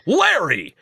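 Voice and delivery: triumphant shout of a name. Retold or imitated. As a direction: call the name out like a victory.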